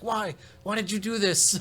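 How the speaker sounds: put-on high, disbelieving German accent; mock German accent, incredulous. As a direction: demand why in a German accent. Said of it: "lecturing me".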